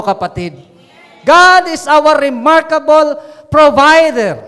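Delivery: forceful proclamation to the congregation; impassioned preaching. Real, not acted. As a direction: preach it at full force, driving each line home. Preacher builds to full-force proclamation.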